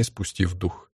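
usual speaking voice

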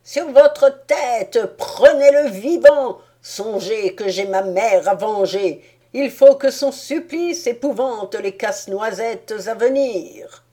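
the mouse king screams at his troops for revenge; mouse king shouting. A narrator's reading. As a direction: bellow orders to seize the prisoner. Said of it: "la voix du roi des souris qui criait".

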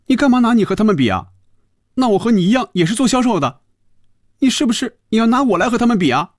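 fast, high-pitched indignant outburst; huffy anger. A narrator's reading. Phoebe objects to being compared with them, angry. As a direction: fire off accusing questions, high and loud. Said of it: "菲比气呼呼地说".

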